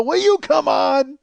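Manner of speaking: high, loud, drawn-out "come on"; exasperated scolding. Retold or imitated. Onlooker ordering him out of the chair, exasperated. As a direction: scold loudly, totally fed up.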